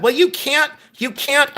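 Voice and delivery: high, mocking imitation of a scolding reseller; mocking naysayer. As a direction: parody the know-it-alls who say you can't. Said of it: "other people said".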